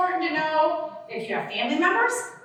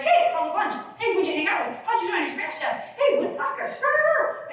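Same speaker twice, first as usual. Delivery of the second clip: higher, rapid-fire chirpy questions; eager questioning parent; 6 semitones higher than usual. Retold or imitated. Parent quizzing daughter after school, eager. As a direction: pepper her with questions, bright. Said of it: "she gets in the car and is like".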